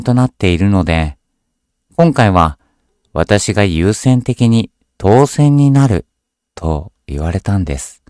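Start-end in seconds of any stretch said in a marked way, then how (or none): none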